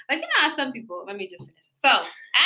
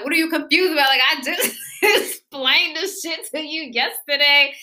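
mock exasperation; 5 semitones higher than usual. Retelling frustration at a confused girlfriend, mock exasperated. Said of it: "She's still confused. I'm like".

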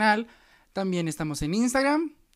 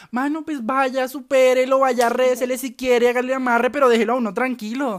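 exasperated rant; 5 semitones higher, 7 dB louder than usual. Real, not acted.

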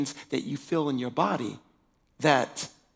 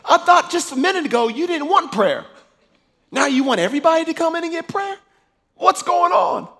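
baffled protest; 14 semitones higher, 10 dB louder than usual. Retold or imitated. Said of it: "And I'm like".

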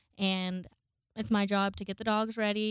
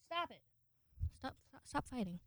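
soft, higher-pitched aside to the dogs; hushed scolding; 3 semitones higher, 12 dB quieter than usual. Real, not acted. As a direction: scold the dogs away from the mic. Dogs are wrestling behind me.

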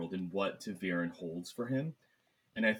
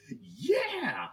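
excited cheer; 4 dB louder than usual. Retold or imitated. Cheering Perrin's wolf transformation.

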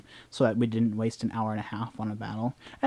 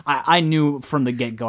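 normal talking voice

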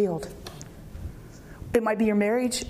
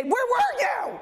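higher, demanding cry; accusing Martha; 10 semitones higher, slower than usual. Acted. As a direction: fling it at him, hurt and sharp. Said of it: "runs out to Jesus to say".

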